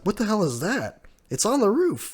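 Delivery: rising alarm at something overhead; shocked. Retold or imitated. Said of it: "He's like".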